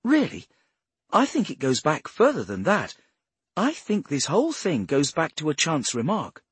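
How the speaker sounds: high-pitched, insistent explainer; earnest Bradshaw. Acted. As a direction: pitch it up and press the point.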